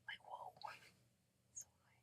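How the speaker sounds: very soft, airy whisper; hushed stoned awe. Acted. Recalling a first high to the listener, hushed stoned awe. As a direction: barely voice it, dazed and awed.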